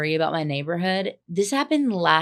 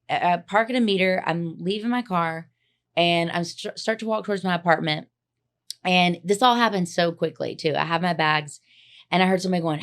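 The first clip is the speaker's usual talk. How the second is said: ordinary talk, nothing marked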